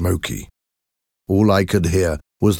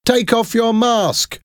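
much higher mocking children; children jeering at a stranger; taunting shout. A narrator's reading. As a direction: goad him to unmask. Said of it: "they shouted".

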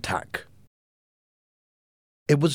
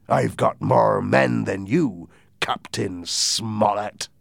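pirate demand; 5 semitones higher, 6 dB louder than usual. A narrator's reading. Silver demands the map.

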